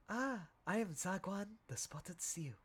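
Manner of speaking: a polite seal introducing itself; lightly voiced seal. Voicing seal character intro.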